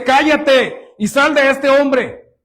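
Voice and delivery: loud, high, slow command; preacher voicing a commanding expulsion; acted rebuke. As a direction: order the demon out forcefully. Acts out Jesus rebuking the demon.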